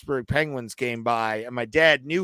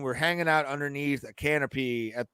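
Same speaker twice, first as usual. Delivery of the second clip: ordinary talk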